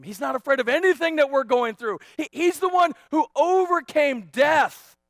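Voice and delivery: full-force preaching. Real, not acted.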